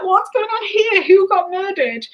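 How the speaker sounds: high, alarmed mock disbelief; mock shock. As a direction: play up the shock at the taped floor. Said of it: "we were like".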